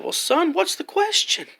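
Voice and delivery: put-on high old lady voice; old lady coaxing the boy, high. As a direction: coax him like a sweet granny. Old lady at the bus stop.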